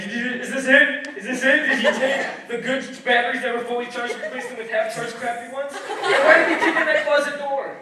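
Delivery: loud, high, frantic rant; hammed-up hysterical outburst, loud and high; having a breakdown. Husband loses it over what was stolen, frantic. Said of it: "He starts having a breakdown".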